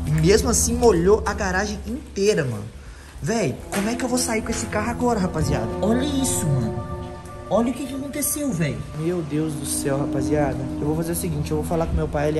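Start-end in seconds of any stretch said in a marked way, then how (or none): none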